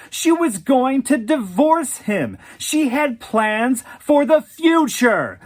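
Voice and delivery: high, loud, indignant tirade; furious argument. Acted. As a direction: hammer him with every point. She argues furiously with boyfriend.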